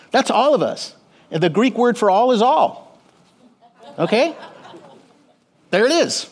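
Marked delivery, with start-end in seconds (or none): none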